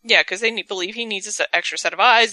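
ordinary talk, nothing marked

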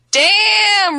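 high, loud, stretched-out cheer; drawn-out, loud exclamation; excited exclamation. Acted. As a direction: belt it out long and high. Exclaims while reading excited tweet.

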